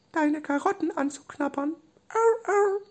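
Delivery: tiny, very high squeak; squeaky Robby voice. Acted. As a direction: squeak like a small stuffed animal. Robby antwortet Doktor Löffel. (hohe, piepsige Stimme)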